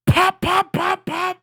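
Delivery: loud, high spoken pop pop; mock gunshots. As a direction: bang them out like shots. Imitating gunshots.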